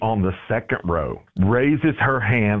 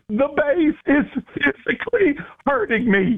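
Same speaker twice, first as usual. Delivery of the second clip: host voicing the lady begging to turn the bass down; high pleading lady voice. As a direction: beg like the tearful church lady. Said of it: "she goes".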